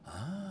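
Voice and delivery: drawn-out ah sliding up high; long rising ah at normal volume. A narrator's reading. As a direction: stretch out a knowing "ah". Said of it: "fece un lungo".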